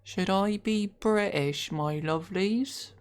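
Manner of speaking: slow, put-on British accent; exaggerated British accent, slowly drawn out. Shown as a demonstration. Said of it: "I'm not doing that accent".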